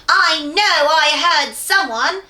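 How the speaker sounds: goblin. Acted. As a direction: growl it slowly like a suspicious goblin. Goblin voice in story.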